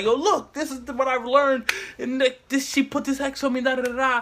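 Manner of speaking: high, fast, worked-up telling; hyped up, pitch it high; excited. Retold or imitated. Showing friends the hex slide.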